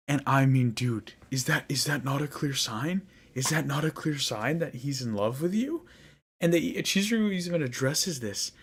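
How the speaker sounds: incredulous. Real, not acted. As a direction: ask it like it's painfully obvious.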